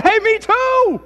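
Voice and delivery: very high, loud, slow gushing; gleeful rescuer. Acted. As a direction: stretch out the joy. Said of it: "He said".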